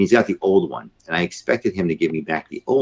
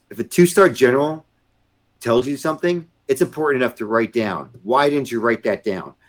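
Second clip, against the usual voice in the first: high, raised voice of an angry general; retold stern yell; 7 semitones higher than usual. General scolding captain over note-taking, retold stern yell. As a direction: imitate a superior chewing out a junior. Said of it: "he yelled at me".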